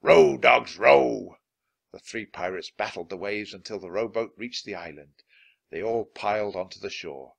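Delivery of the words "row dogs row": louder, hard rowing order; captain yelling at rowers in storm; pirate command shout. A narrator's reading. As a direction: drive the crew to row.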